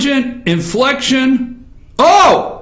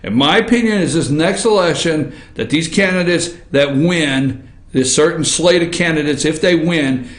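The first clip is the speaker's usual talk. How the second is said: much lower, earnest and measured; serious, toned down; 8 semitones lower than usual. Real, not acted. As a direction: bring it down and mean every word. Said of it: "let me try to ratchet this down and get serious".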